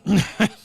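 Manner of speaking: sudden loud high laugh; a loud, high burst of laughter. Real, not acted. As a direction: crack up loudly. Laughing at his own story.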